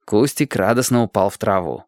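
usual speaking voice

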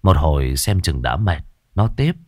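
usual speaking voice

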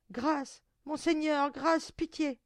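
high, loud begging; desperate pleading. A narrator's reading. Doomed girl begging the monster for mercy, high plea. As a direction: plead urgently for your life.

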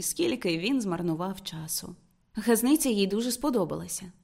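usual speaking voice

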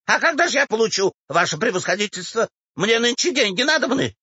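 high, whining demand; insistent old woman. Acted. Old woman asks the official for money, insistent. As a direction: press him for money in a high voice.

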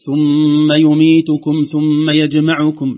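slow, loud ثم يميتكم ثم يجمعكم restarted mid-list; drawn-out, emphatic ثم يميتكم; wrong restart. Shown as a demonstration. Teacher demonstrating the wrong restart mid-verse. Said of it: "لا ينبغي علينا".

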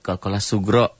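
ordinary delivery

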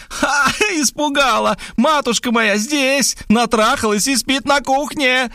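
put-on high girlish gleeful voice, slow on the reveal; gleeful yell. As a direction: crow with triumph. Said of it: "радостный вопль Варвары". (высокий ликующий девичий вопль)

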